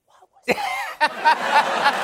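high, laughing fake surprise; mock innocent surprise. Boss walking in after the yelling, feigning surprise. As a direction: act like you heard nothing, then laugh through it.

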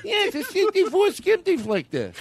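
high nasal car-buff voice; gushing car collector voice; Jay Leno impression. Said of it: "Jay Leno would say".

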